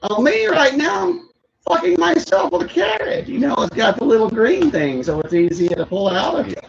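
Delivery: high, shouted mom voice; Sandler's mom voice. Retold or imitated. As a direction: shriek it like a gleeful mom. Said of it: "I do a good impersonation of that".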